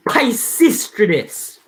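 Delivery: slow "Pisistratus", stressed on SIS; careful name practice. Shown as a demonstration. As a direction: repeat "Pisistratus" slowly to get it right.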